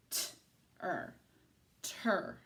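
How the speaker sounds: "T-er" as separate t and er, then blended; sounding out. Shown as a demonstration. Teacher showing the second syllable, sounded out. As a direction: split "T-er", then join it as "ter". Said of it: "look at the second syllable".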